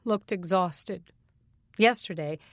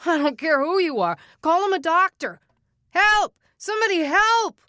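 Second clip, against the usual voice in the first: much higher, much louder desperate yell; frantic shout; 10 semitones higher, 8 dB louder than usual. Acted. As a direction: scream for help down the hall. Patient screaming for a doctor.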